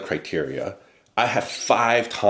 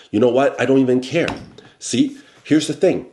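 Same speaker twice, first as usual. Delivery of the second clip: louder and higher, fed up; angry outburst; 6 semitones higher, 4 dB louder than usual. Retold or imitated. Salesman confronting his manager over the raise, angry raised voice. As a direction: suddenly raise your voice at the boss.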